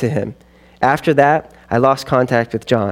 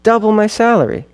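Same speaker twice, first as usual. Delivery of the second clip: thrilled, voice jumping higher; excited, high pitched; 8 semitones higher than usual. Retold or imitated. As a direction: say it dazzled by the money. Echoing the tempting offer.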